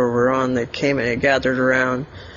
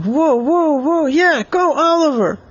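excited cheering; 12 semitones higher than usual. Acted. Elliot cheering Oliver as he runs with the ball, high and excited. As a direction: cheer your friend on. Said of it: "And Elliot was like".

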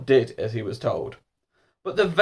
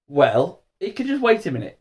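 insistent lady dentist, pitched up; firm; 6 semitones higher than usual. A narrator's reading. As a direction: put your foot down firmly. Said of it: "said the lady dentist firmly".

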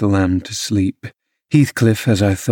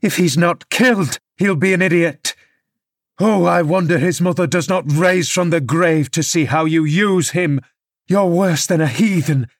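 higher, indignant rebuke held through; angry scolding; 6 semitones higher than usual. Acted. Nurse berating the drunk father over his son, higher and angry. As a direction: scold him for his cruelty, pitch up. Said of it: "I cried angrily".

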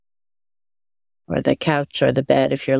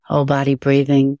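guided meditation. Acted. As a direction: guide attention gently through the body.

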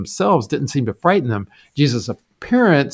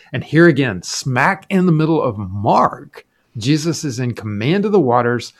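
ordinary talk

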